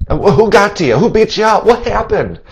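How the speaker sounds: high, urgent questioning; shocked pastor. Acted. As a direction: demand to know who hurt her. Pastor asking who attacked her.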